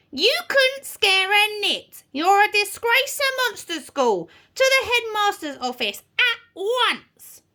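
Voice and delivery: high, scolding tirade; bawling witch teacher. A narrator's reading. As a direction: scold the disgraced pupil and send them out. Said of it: "bawled Miss Spell".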